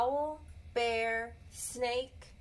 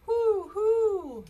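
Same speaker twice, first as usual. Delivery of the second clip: high, loud owl hooting; 12 semitones higher, 7 dB louder than usual. Acted. Child answers mother with a loud owl hoot. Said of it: "you ask".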